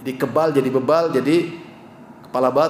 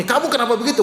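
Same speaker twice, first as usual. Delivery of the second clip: much higher, mock scolding nag; nagging mother; 9 semitones higher, 3 dB louder, faster than usual. Mother nagging her child, much higher mock scold. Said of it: "Ngomel melulu".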